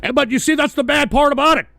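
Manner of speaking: ordinary delivery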